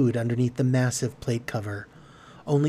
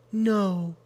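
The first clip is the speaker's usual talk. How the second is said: high, gentle, saddened voice; soft, pained sadness; 8 semitones higher than usual. A narrator's reading. Carolyn answering Theodora's question, softly sad. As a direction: answer softly with quiet hurt. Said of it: "Carolyn said softly".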